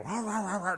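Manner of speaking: high yappy mock barking, like a pack of dogs; a pack of dogs barking, played for laughs; imitating barking dogs. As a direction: bark it out like the dogs charging. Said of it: "they go".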